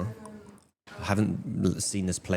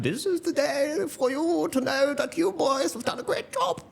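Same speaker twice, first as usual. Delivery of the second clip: very high, hammed-up royal voice; squeaky mock monarch making a proclamation; the Queen. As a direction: imitate a queen in piping falsetto. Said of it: "And the Queen was like".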